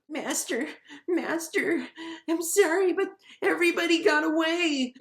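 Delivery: very high, cringing goblin voice; fearful goblin minion. Retold or imitated. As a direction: squeak apologetically to a feared master. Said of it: "like he's all afraid of this guy".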